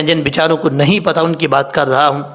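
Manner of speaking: ordinary delivery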